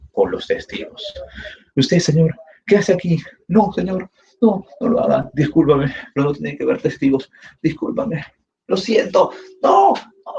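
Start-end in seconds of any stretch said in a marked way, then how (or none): 1.8-10.3 mock pleading. Pleading sister before the murder.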